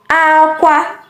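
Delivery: "Aqua" high on both syllables; high-high tones on "Aqua"; cry tone. Shown as a demonstration. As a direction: hold "Aqua" high and level to the end. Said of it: "you have to listen attentively".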